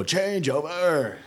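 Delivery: slow, high drawn-out command call; drill command. Retold or imitated. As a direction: stretch it out like a drill order. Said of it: "they'll go".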